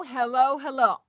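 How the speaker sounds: normal talking voice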